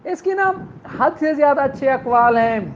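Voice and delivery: ordinary talk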